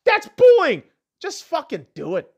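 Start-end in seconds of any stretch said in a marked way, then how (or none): 0.0-0.8 mock whiny complaint. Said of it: "Go kill yourself".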